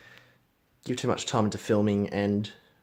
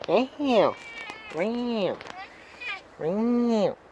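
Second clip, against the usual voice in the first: high-pitched imitated cat meows; sing-song mock meowing at a pet; 10 semitones higher, much slower than usual. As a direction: meow up high to call the cat. Calling to the cat.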